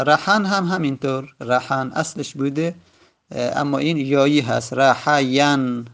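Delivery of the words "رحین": رحین drawn out very slowly, syllable by syllable; spelled-out pronunciation. Shown as a demonstration. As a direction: stretch رحین slowly so each syllable is clear. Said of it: "رحین یایی هست با یا".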